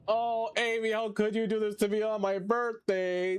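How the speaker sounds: high hammed-up grief; mock lament. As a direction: wail at Amy like a soap heroine.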